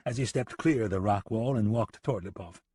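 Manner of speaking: ordinary delivery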